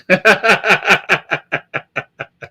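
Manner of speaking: breathy high laugh at the quote; amused laugh at a hater's comment. Real, not acted.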